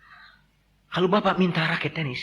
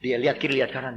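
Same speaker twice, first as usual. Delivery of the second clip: ordinary talk, nothing marked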